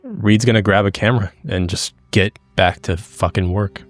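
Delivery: usual speaking voice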